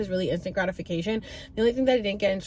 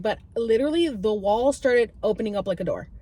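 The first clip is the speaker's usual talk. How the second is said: ordinary delivery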